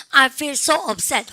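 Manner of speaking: each word drawn out for students to repeat; slow, careful classroom demo sentence; slow model reading. Shown as a demonstration. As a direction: read it very slowly and clearly, like a teacher's model. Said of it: "시작".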